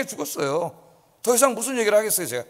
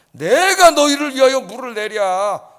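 loud, slow, angry outburst at the people; angry shout; 6 semitones higher, 8 dB louder, slower than usual. Retold or imitated. Angry slow shout as Moses. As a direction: Moses shouting at the grumbling crowd in anger. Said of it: "소리를 지르더니".

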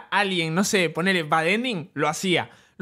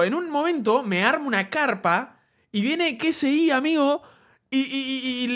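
normal talking voice

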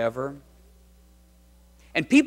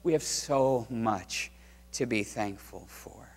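much softer and lower, sincere; 7 semitones lower, 10 dB quieter than usual. Real, not acted. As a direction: let it sink in quietly. Preacher drops voice, sincere.

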